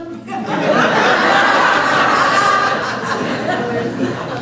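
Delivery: long airy laugh; high breathy laugh. Real, not acted. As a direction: laugh at your own folly. Laughing at own story.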